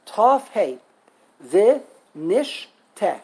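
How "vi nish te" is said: "Vi, nish, te" split by pauses; syllable by syllable. Shown as a demonstration. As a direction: separate each syllable: Vi, nish, te. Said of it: "How many syllables are there?".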